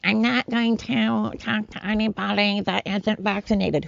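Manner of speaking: high-pitched mock celebrity voice; mocking Jennifer Aniston. Mocking a celebrity refusing to talk to unvaccinated people, high put-on voice. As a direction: imitate a self-important star, pitched well above your own. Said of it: "celebrities like Jennifer Aniston".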